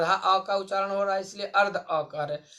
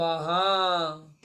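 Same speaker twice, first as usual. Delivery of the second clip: usual speaking voice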